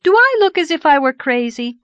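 high, loud, playful solemnity; mock-solemn excitement. A narrator's reading. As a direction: ask gravely with suppressed glee. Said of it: "solemnly, but with dancing eyes".

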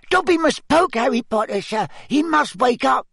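very high, squeaky elf voice; squeaky house-elf. Acted. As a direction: squeak it like a tiny anxious elf. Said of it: "squeaked Dobby".

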